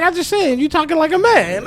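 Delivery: put-on high-pitched kid defending himself; little son. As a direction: imitate a small boy explaining, amused. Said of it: "He's like".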